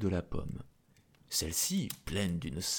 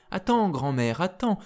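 raised, higher-pitched call; 9 semitones higher, 4 dB louder than usual. Acted. Princess calls down to the beggar woman, crying out. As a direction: call urgently from a window. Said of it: "lui cria-t-elle par la fenêtre".

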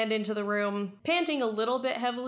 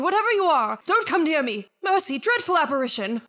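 higher, louder cry for mercy; terrified plea; 7 semitones higher, 7 dB louder, faster than usual. Acted. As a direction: push the apparition away in panic. Miser begs ghost away.

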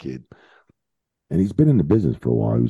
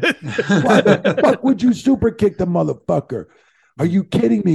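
much higher, slowed rant broken by laughter; laughing mock rant; 4 dB louder than usual. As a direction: try to scold while cracking up. Laughing while retelling his rant.

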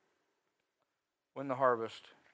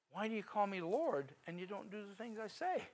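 higher, softer plea; 6 semitones higher, 5 dB quieter than usual. Retold or imitated. Quotes Jesus again, softer.

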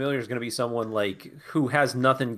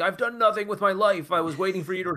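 a light hint of the old German guy, pitched higher; half-hearted old man voice, pitched higher; 8 semitones higher than usual. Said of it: "like the old German guy".

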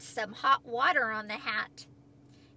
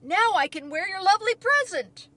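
loud, high-pitched delighted announcement; Toad's excited cry; 6 semitones higher, 10 dB louder than usual. A narrator's reading. Toad tells Frog the hat fits now, crying out excitedly. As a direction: burst out with good news. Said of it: "he cried".

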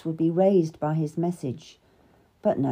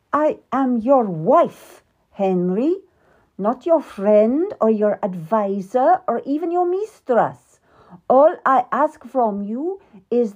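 louder, higher, slow insistence; indignant queen; 4 semitones higher, 5 dB louder than usual. Acted. Queen to King Henry, indignant and hurt. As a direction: demand your rights as wife. Queen demands to be treated as wife.

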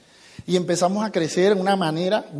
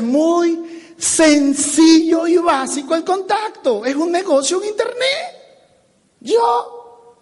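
very high, stretched-out voice climbing higher; exaggerated simplicity; 8 semitones higher, much slower than usual. Shown as a demonstration. Trainer shows how simple contacting is, exaggeratedly singsong. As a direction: spell it out sky-high, as if it were child's play.

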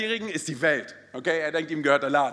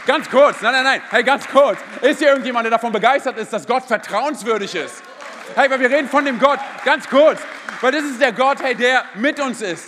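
loud, fired-up preacher calling out to the church; rally the congregation with big energy; rousing church call-out; preaching at full force; 3 semitones higher, 6 dB louder than usual. Acted.

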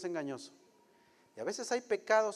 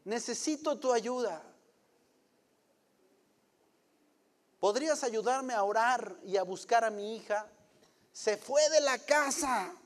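higher, urgent calling of pastor; desperate plea; 6 semitones higher, 4 dB louder than usual. Retold or imitated. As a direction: call out pastor, pitched up in panic. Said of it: "desesperado me dijo".

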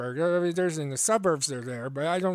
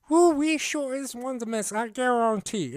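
raised-pitch, hammed-up Cajun exclamation; Cajun accent; 6 semitones higher than usual. Quoting a bayou local's favorite word, exuberant Cajun imitation. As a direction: whoop it like a bayou Cajun caricature. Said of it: "the one word that I heard".

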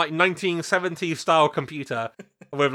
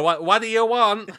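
put-on raised pitch, rough Brooklyn accent; 5 semitones higher than usual. Pretend secretary answering callers, put-on Brooklyn accent. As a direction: attempt a brusque Brooklyn receptionist accent. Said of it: "like, a Brooklyn accent".

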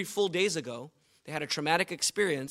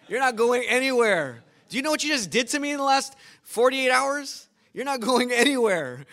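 loud, high pleading disciple voice, won't let go; clinging disciple; 7 semitones higher, 8 dB louder than usual. Retold or imitated. Women at Jesus' feet refusing to let him go, loud and pleading.

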